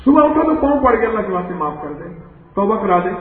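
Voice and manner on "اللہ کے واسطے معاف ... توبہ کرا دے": soft, fast begging; hushed pleading. Retold or imitated. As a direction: plead quickly and quietly for pardon. Thief begs forgiveness at feet.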